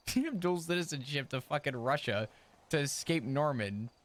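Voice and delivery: ordinary delivery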